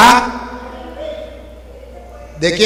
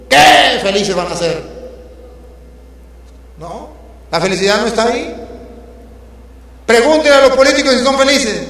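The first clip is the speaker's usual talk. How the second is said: fiery preaching; 2 semitones higher than usual. Acted. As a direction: hammer the message home to the congregation. Preaching at full force.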